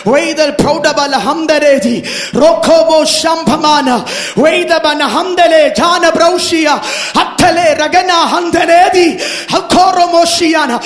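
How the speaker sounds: loud, rolling, rhythmic ecstatic prayer; praying in tongues. Real, not acted. Prays in tongues.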